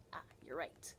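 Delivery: quiet whispered praise. Real, not acted. Soft aside praising a child.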